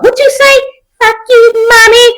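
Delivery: high mock child sass; squeaky imitation of a rude kid; mocking bratty kid. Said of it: "They what?".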